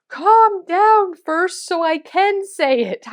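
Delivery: loud, high, drawn-out imitation of a husband; exasperated husband. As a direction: order her to calm down, stretching the words. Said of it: "and to him saying".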